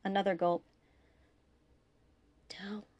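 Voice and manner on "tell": faint, breath-starved word; gasping. A narrator's reading. Dying Malcolm leaving a message through gasps, gasping. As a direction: force it out with failing breath.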